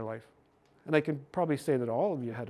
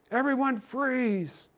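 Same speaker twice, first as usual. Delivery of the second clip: high, drawn-out imitation of a yell; mock mom yell; 10 semitones higher, much slower than usual. Mom to three running girls, mock high yell. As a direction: call it out slowly like a mom, without full volume. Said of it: "And the mom yelled".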